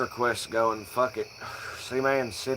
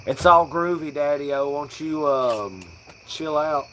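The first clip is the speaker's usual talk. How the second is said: southern hippie slang; 3 semitones higher than usual. Shown as a demonstration. Stoner demoing hippie slang, Southern twang. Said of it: "southern hippie redneck slang sound".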